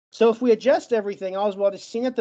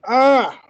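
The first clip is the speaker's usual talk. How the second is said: frustrated groan; 4 semitones higher, 7 dB louder than usual. Real, not acted. Groan while blocked on a word.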